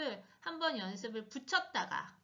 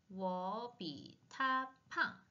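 slow drill; 2 semitones lower, much slower than usual. Shown as a demonstration. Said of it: "slow model reading".

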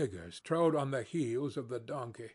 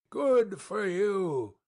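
slow, higher and louder joyful cry; cried out; 6 semitones higher, 5 dB louder, much slower than usual. Acted. Boiler-maker cheering the narrator, a slow loud cry. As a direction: whoop it out slowly. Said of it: "he cried".

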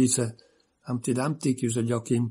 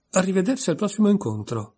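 forced cheer, pitched higher; cheerful; 8 semitones higher, faster than usual. A narrator's reading. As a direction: say goodbye as brightly as she can. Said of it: "mettendoci quanta più allegria possibile". (allegria forzata, voce più acuta)

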